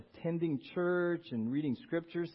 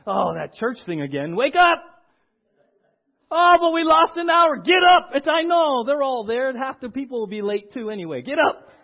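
loud, high yell to get everyone out of bed; yelling wake-up call; 8 semitones higher, 13 dB louder than usual. Retold or imitated. Parent rousing a sleepy family for church, loud high yelling. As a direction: parent shouting the family awake on Sunday morning.

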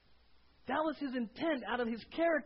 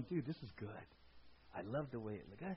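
softer, content murmur to yourself; quiet satisfaction; 11 semitones lower, 11 dB quieter than usual. Retold or imitated. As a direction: admire your own work in a hush. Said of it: "you're sitting back there going".

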